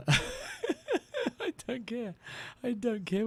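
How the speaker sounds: squeaky laugh running into the words, very slow and high. Real, not acted. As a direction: laugh your way through it. Laughing at name joke.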